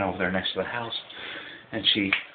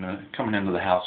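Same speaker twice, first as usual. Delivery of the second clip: normal talking voice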